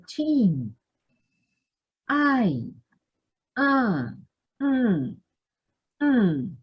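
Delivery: "chin", "Ay", "Ah", "Mm", each falling from high; falling fourth tone. Shown as a demonstration. Teacher lists examples of the tone, falling fourth tone. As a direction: start each of "chin, Ay, Ah, Mm" high and let it drop. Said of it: "Ese es el tono".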